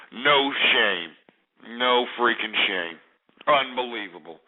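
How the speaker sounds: low, seething contempt; cold disgust. Real, not acted. Host condemning caller who has no shame, grimly disgusted. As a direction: speak low, appalled and scornful.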